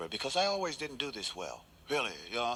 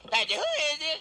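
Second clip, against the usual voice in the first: high, loud exasperated shout; angry shouted call; 6 dB louder than usual. Acted. Plumber banging on the door shouts high and loud. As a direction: yell it through the door.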